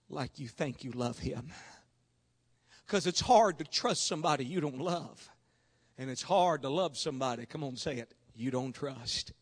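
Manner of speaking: low, soft preacher's voice with long pauses; hushed pastoral appeal. Real, not acted. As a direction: drop right down and search their hearts.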